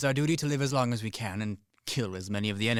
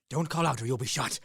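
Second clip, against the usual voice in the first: normal talking voice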